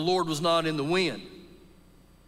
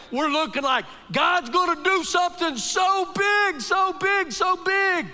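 high, loud preacher's cry, building; loud, soaring sermon climax; rising preaching shout; 9 semitones higher, 5 dB louder than usual. Acted. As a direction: lift it to a shout on so big. Preacher building to big miracle.

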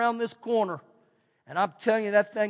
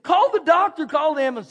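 panicked call; 6 semitones higher, 6 dB louder than usual. Retold or imitated. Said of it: "she's saying".

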